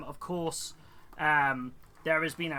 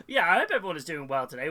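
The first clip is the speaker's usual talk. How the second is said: ordinary delivery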